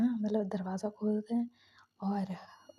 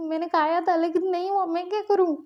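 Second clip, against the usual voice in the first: higher, put-on girlish plea; helpless pleading; 7 semitones higher than usual. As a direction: whine it out helplessly, high.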